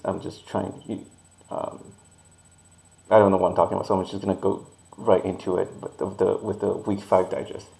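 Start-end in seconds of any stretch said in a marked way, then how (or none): none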